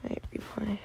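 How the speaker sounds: soft whispered aside; whispering. Real, not acted. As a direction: whisper the request.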